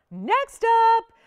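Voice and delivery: high, loud, drawn-out call; loud announcement. Real, not acted. Host introducing the next ring, loud announcement. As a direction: announce it big, stretching the words.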